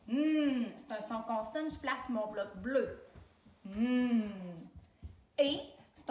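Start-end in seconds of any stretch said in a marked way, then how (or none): 0.1-0.7 HMM as a long, loud N hum; consonant sound. Shown as a demonstration. Teacher isolating a sound of journée, HMM held as N. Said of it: "Je prononce les sons". 3.6-4.3 HMM as a sustained, louder N hum; consonant sound. Shown as a demonstration. Teacher sounding N while writing it, HMM held long. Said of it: "Je prononce les sons".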